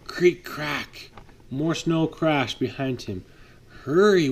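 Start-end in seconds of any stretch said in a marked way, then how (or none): 3.8-4.3 loud higher urgent call; urgent hurry. Acted. Will urging the snowplow during the rescue, loud. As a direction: push him to go faster. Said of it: "Will said".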